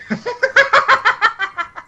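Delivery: high-pitched maniacal cackle; maniacal laugh. Acted. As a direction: cackle like a bloodthirsty madman. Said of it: "he just starts laughing maniacally".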